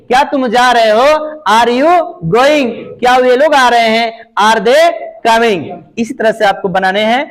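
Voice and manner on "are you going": usual speaking voice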